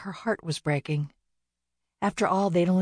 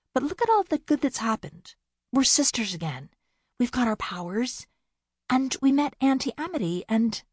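eager listing; 8 semitones higher than usual. Acted. Bea lists good things.

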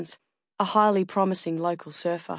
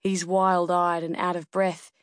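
ordinary delivery

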